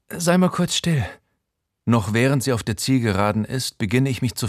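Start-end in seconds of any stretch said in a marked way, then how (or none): 0.1-1.0 higher, brisk female voice; a sharp little hush from Jenny; Jenny's voice. Acted. Jenny hushing the narrator, higher brisk voice.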